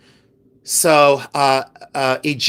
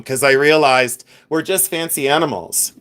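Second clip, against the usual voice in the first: normal talking voice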